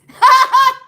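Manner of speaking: bright burst of laughter; a real high laugh. Real, not acted. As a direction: laugh out loud at the slip. Laughing at her own slip.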